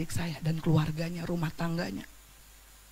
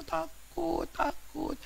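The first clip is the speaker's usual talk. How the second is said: frightened whisper; 8 semitones higher, 10 dB quieter, much slower than usual. Retold or imitated. Sister's fear fading out.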